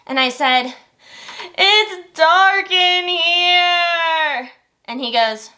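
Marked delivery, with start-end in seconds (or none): 1.6-4.5 higher, very slow, sing-song whimper. Retold or imitated. Crying wife answers husband from dark bathroom, whimpering. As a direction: wail it like a lost child.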